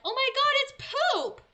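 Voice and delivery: high, loud disgusted shriek; high, loud shriek on finding it's poop; shocked realization. Retold or imitated. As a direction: recoil at the smell out loud. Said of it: "I'm like".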